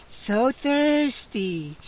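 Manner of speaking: slow, stretched, eerie vampire hiss. A narrator's reading. Scene: vampire Gwendolyn hisses at the trapped kids, slow eerie. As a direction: drag each word out, hungry. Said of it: "she hissed".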